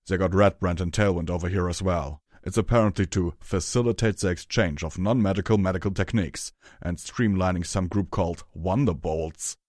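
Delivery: loud, rough character voice; Hammer Chain's gruff voice. Acted. Hammer Chain explains his posting to Rarity, loud gruff voice. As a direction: explain it loudly and bluntly.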